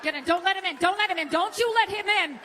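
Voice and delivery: ordinary delivery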